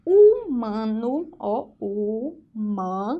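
slow HUMANO split as u-ma-no; syllable by syllable. Shown as a demonstration. Teacher breaks the word up for pupils, syllable by syllable. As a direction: say HUMANO in separate, measured syllables.

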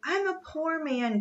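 high, plaintive groan; acted self-pitying groan. A narrator's reading. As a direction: lament like a hard-done-by trader. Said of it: "groaned the Yankee trader".

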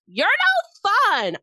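high, slow mock scream; mock scream at the VIP section, high and drawn out. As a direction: shriek it like a pop star scolding fans. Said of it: "She's had to scream at her V I P section".

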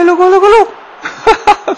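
high, loud, excited yell; excited shout. Real, not acted. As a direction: cry out as the shot flies in. Near-goal chance in match.

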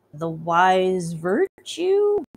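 very slow, drawn-out answer; hesitant guess. Acted. Player guessing the priest's riddle, very slow. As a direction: stretch it out, unsure.

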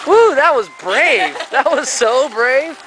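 high, slow, cheering praise; excited praise. Real, not acted. Praising a child's jump.

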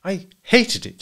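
high, emphatic bitterness; bitter. A narrator's reading. Wife repeats herself when asked why, bitterly. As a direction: throw it back bitterly. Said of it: "she said bitterly".

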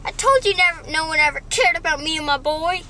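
hammed-up self-pity; wailing mom. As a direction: cry it out like a wounded mother. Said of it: "she's like".

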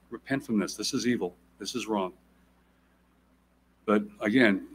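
ordinary talk, nothing marked

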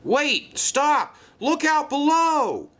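very high warning cry; alarmed shout. A narrator's reading. Merrick warning of a runaway train, shouting in alarm. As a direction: call out urgently to those below. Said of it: "shouted Merrick".

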